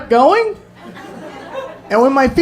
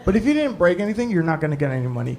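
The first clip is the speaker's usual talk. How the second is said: grudging offer, trailing softer; reluctant cop; 3 dB quieter than usual. Retold or imitated. Cop near end of shift offering report, reluctant. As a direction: offer it as if hoping for a no. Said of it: "He said it like I walked into T G I Fridays ten minutes before they closed".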